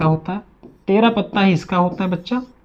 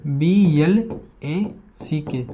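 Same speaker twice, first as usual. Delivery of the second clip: B L A सी के spelled out letter by letter, very slowly; slow spelling; 2 semitones lower, 5 dB quieter, much slower than usual. Shown as a demonstration. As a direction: dictate each letter slowly to the class. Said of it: "तो इसे ऐसे लिखते हैं".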